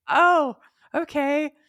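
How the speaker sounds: slowly taking it in, mock revelation; dawning realisation. Acts out her sudden realisation.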